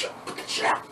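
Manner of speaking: hushed warning whisper to a friend; whispering to Greg to put out the cigarette; urgent whisper. Retold or imitated. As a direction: whisper it fast before security sees. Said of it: "So I'm like".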